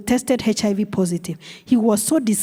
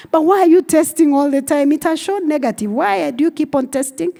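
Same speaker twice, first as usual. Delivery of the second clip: higher, louder baffled questioning; puzzled health worker; 6 semitones higher, 3 dB louder than usual. Retold or imitated. Health worker questions the pastor, loud baffled tone. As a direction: health worker can't believe the repeat tests.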